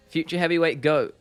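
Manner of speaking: usual speaking voice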